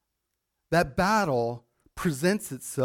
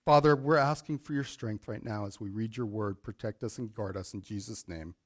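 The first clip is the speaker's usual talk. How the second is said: quiet, lowered prayer voice; 6 semitones lower, 6 dB quieter than usual. Real, not acted. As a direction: pray softly before the reading. Opening prayer before reading.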